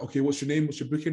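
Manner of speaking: a lightly voiced busy tanner asking questions; imitating the tanner.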